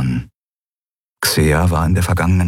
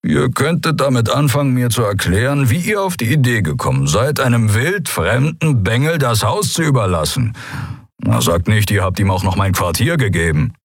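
higher, swooping sarcastic complaint; indignant grumbling; 6 semitones higher than usual. Acted. Keru demands an explanation for the lent house, indignant and swooping. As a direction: scold them with exaggerated rising and falling emphasis.

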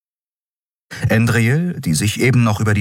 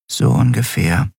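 ordinary delivery, nothing marked

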